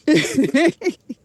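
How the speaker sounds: high giggling laugh; self-amused laugh. Real, not acted. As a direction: giggle at your own weakness. Laughing at own soda habit.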